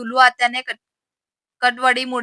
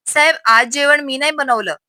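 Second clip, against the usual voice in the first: usual speaking voice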